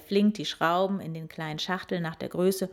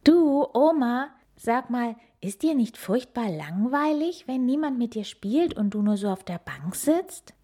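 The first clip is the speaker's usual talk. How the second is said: put-on curious child; 6 semitones higher, 4 dB louder than usual. Child asks grandma.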